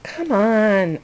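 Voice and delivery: ordinary talk, nothing marked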